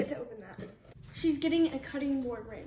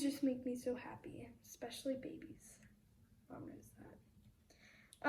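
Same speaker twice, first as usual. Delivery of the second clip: soft whispered aside; 3 semitones lower, 12 dB quieter than usual. Real, not acted. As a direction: confide it in a hush. Whispered confession.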